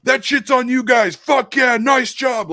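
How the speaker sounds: high, loud celebratory holler at a crowd; full-throated shout imitating the coach; imitated coach yell. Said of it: "he turned around and yelled".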